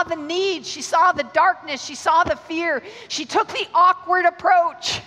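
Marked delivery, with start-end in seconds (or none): 3.1-5.0 loud, high, pushed up hard; fervent raised preaching cry; preaching shout. Real, not acted. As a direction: proclaim it to the back row. Preacher builds to climax.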